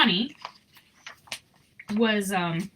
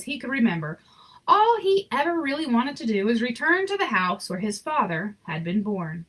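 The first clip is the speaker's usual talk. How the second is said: gentle read-aloud storyteller voice; story narration; 4 semitones higher than usual. A narrator's reading. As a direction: read it like a bedtime picture book. Reading picture book aloud.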